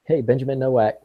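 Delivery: ordinary delivery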